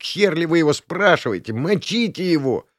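high, wailing incitement; high-pitched yowling troublemaker; Артурчик/character. A narrator's reading. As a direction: egg the gang on in a high voice. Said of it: "заголосил Артурчик".

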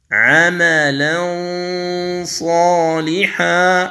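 ينظرون drawn out with the nasal hum held; slow tajweed recitation. Shown as a demonstration. Teacher demonstrating ikhfa before ظ, slow recitation. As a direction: chant ينظرون slowly through the nose. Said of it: "أنتقل إلى الصاد".

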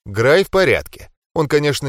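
ordinary delivery, nothing marked

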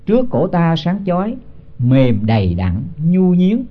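slow, measured chant of the verse; slow scripture chant before explaining it; slow verse recitation. Acted. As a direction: intone each syllable like scripture. Recites verse line slowly.

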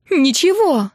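high, indignant cry; angry wail. A narrator's reading. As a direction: wail the retort in fury. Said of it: "ещё громче завопила сестра".

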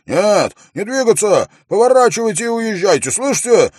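high, very loud, urgent orders; stern guard's shouts. A narrator's reading. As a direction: yell commands at people ignoring you. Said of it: "невзирая на строгие окрики стражника". (строгие громкие окрики стражника)

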